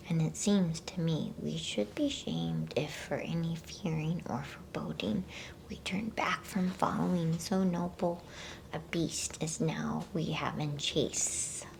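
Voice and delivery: ordinary delivery, nothing marked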